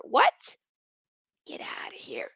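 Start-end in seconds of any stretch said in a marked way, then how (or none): 1.5-2.3 unvoiced whisper; whispered disbelief. Real, not acted. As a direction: breathe it out, no voice at all. Disbelief aside.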